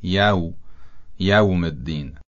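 یوم said "yawm", with an open a; یوم with a clear a before the w; fatha on yawm. Shown as a demonstration. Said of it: "صدای ا داره".

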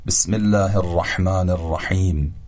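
ordinary talk

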